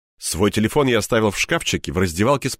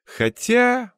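a long drawn-out hmm, pitched high. A narrator's reading. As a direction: stretch the word thoughtfully. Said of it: "протянул он".

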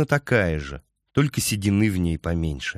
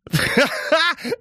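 high, shrill crazed cackle; shrill mad laugh. Acted. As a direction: cackle like a madman. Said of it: "визгливо, точно безумец, захохотал".

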